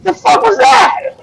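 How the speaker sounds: very high, slow bewildered squeal; baffled sailor. Acted. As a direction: squeak it out in disbelief. Crew reacting after fire.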